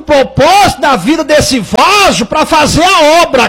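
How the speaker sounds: loud, high shouted sermon; fiery preaching rebuke. Real, not acted. Preacher demanding of an idle believer where the work is, fiery rebuke. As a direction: hurl each question at the congregation at full force. Rebukes congregant at full force.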